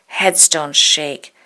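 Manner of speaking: plain reading voice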